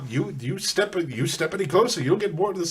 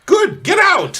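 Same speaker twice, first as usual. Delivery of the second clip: shouted dismissal; 13 semitones higher, 8 dB louder, slower than usual. Acted. Ordering the fiend away.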